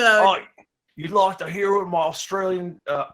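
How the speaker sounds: Australian accent. Shown as a demonstration. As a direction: show off your Australian accent, laughing. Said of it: "might we hear a sample? Of your Australian accent?".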